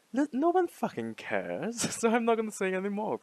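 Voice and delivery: high-pitched, exasperated with a laugh; laughing dismissal. Real, not acted. Dismissing her own worry, laughing.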